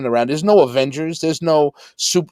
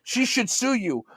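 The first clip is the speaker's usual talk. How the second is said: higher-pitched mock indignation; indignant bystander; 7 semitones higher than usual. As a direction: retell it as a righteous, scolding onlooker. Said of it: "he's arguing with people".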